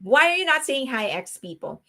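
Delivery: normal talking voice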